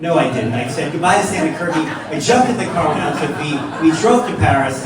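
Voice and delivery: triumphant, loud admission to the crowd; loud gleeful confession. Real, not acted. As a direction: belt it out over the laughter. Gleefully confesses reversal over audience laughter.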